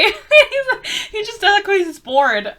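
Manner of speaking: high, loud words through real laughter; laughing. Real, not acted. Reviewer amused that Luc is just bored, laughing. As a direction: crack up while explaining. Cracks up over Luke's behaviour.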